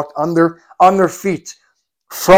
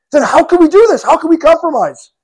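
high, loud indignant protest; heated protest; 9 semitones higher, 3 dB louder than usual. Retold or imitated. Umar protesting to Abu Bakr in heated indignation. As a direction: demand it in frustration.

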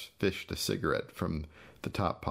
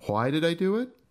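high, loud sarcastic echo; mocking question; 12 semitones higher, 5 dB louder than usual. Gus mockingly repeating his brother's question, high and loud. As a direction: throw his question back at him.

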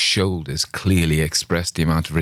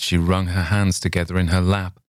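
plain reading voice

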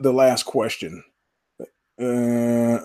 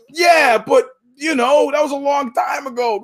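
much higher, shouted justification; mock excuse; 10 semitones higher than usual. Shooter shouts his excuse at the picnic. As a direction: yell it like it's obvious.

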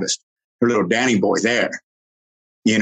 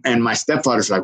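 ordinary delivery

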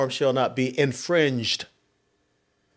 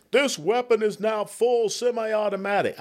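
higher-pitched imitation of a clueless pundit; mock quote; 8 semitones higher than usual. Colonel quoting a baffling CNN pundit, mocking. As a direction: parrot the pundit's line in a raised voice.

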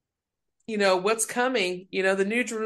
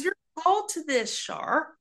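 pastor quoted; 11 semitones higher, 3 dB quieter than usual. Retold or imitated. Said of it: "He goes".

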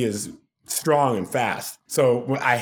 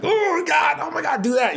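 very high, strained mock scream; mock inner yell; faster than usual. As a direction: imitate yelling inside your head, squealed high. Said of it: "I'm yelling in my head".

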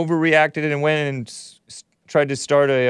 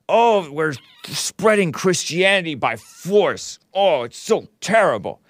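higher, slow put-on whine; mock pious whine; 4 semitones higher than usual. Host mimics critics of the Crusades in a slow mock whine. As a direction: mimic sanctimonious critics, dragging it out. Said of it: "When I talk like that, I make my throat itch".

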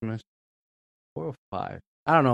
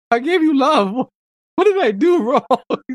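mock hurt parent; 10 semitones higher, 4 dB louder than usual.

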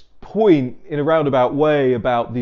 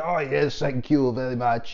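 put-on light French accent on thanks; a hint of French accent, politely pleased. Macron thanking the stranger, in a light French accent. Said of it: "that's my French accent".

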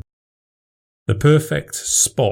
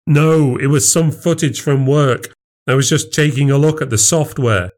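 flustered husband; 6 semitones higher than usual. Acted. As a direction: cover up, a bit too eager. Husband covering his tracks.

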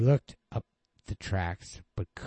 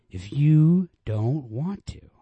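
higher, louder, slow pleading; drawn-out plea; 9 semitones higher, 10 dB louder, slower than usual. Acted. Man pleading with the girl, slow and high. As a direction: stretch the plea out.

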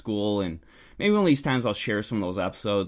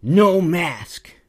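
loud, high imitation of an outraged scold; mock tizzy; 6 semitones higher, 7 dB louder than usual. Stranger scolds the maskless shopper, in a mock tizzy. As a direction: burst out as a flustered busybody, hammed up. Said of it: "he's all just in a tiz".

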